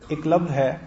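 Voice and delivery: ordinary talk, nothing marked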